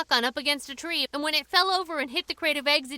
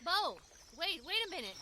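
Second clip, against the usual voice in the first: hushed urgent call; 3 semitones higher, 14 dB quieter than usual. Acted. Sneaking up to the sheriff.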